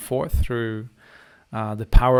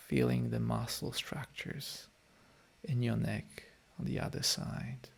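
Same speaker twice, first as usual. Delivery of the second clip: guided meditation; 9 dB quieter than usual. Acted. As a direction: gently ease the listeners back into their bodies. Leads a closing guided meditation.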